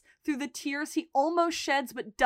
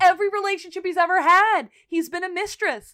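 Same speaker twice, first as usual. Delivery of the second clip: higher, louder heated rant; emphatic rant; 6 semitones higher, 8 dB louder than usual. Real, not acted. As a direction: hammer home the hurt. Emphatic rant about Ian.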